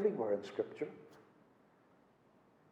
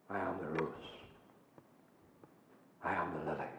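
much lower, soft and hushed; 12 semitones lower, 16 dB quieter, faster than usual. Acted. Preacher voicing Christ to sinners, hushed and low. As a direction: say it like a reverent secret.

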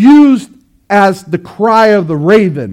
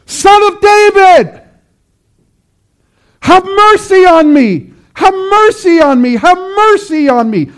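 desperate scream; 10 semitones higher than usual. Acted. Preacher acting blind Bartimaeus crying out to Jesus, desperate scream. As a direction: scream it out, pleading to be heard. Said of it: "He screams and he cries out of desperation".

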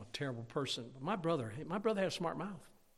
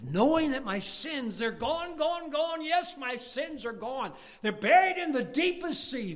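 high, loud jubilant proclamation; full-force preaching; 11 semitones higher, 9 dB louder, slower than usual. Real, not acted. Preacher on sins being gone, exultant shouting. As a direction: belt it out like a revival preacher.

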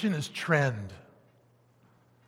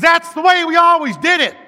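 high, loud mocking quote; stubborn churchgoer mocked; mock stubborn congregation; 11 semitones higher, 11 dB louder than usual. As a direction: belt it out like a stubborn old-timer. Repeating the congregation's refrain.

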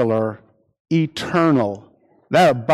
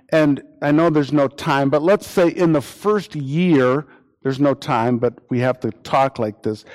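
usual speaking voice

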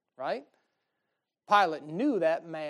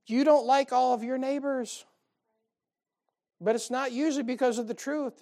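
ordinary talk

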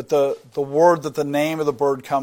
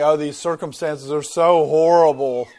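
louder mock whining, a hammed-up grumbler; whiny complainer; 8 dB louder than usual. Preacher mocking a grumbler's complaint, loud whine. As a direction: moan about your lot like a chronic complainer. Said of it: "always saying".

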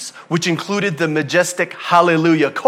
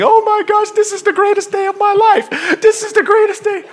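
very high, loud squealing yell; screaming, ecstatic child; 5 dB louder than usual. Acted. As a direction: imitate a kid shrieking with joy over a gift. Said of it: "screaming and yelling and crying".

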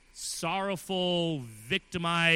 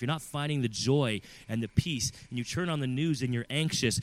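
ordinary delivery

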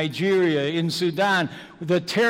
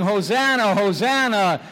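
crowd's cry; 4 semitones higher, 5 dB louder than usual. Retold or imitated. Crowd crying out at the entry.

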